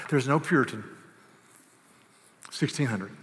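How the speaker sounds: usual speaking voice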